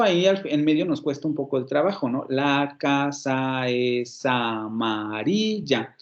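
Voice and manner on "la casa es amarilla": very slow, each syllable separated; slow syllabified demo. Shown as a demonstration. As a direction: break it into syllables slowly. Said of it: "vamos dividiendo por sílabas".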